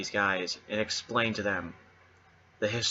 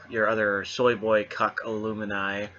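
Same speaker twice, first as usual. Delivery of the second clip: ordinary delivery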